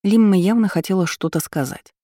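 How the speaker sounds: usual speaking voice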